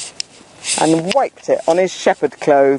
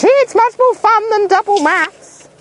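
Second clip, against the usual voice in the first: put-on much higher, delighted kid voice; gleeful child; 13 semitones higher than usual. Pupil glad to skip maths, gleeful. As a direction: squeal it like a kid let out of class.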